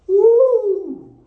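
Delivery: a high delighted hoot; sudden high woo of delight; high excited woo. Retold or imitated. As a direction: whoop up in surprise. Reaction to being accepted.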